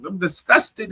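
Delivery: high, slow put-on voice of the wife; mock Skylar voice. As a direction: imitate the disgusted wife, dragging the words. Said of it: "And his wife, Skylar".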